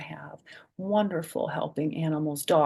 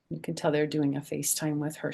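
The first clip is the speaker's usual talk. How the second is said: ordinary delivery, nothing marked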